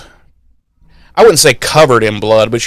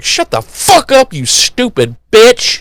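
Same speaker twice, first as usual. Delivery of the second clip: high, hammed-up furious outburst; imitating an angry witch telling someone off; dark Willow snapping; 11 semitones higher than usual. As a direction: punch out each word in mock rage.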